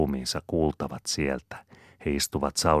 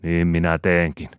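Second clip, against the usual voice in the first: louder, firm threat; threatening; 3 semitones higher, 8 dB louder than usual. A narrator's reading. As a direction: declare it as a threat. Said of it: "uhkaa Malakias".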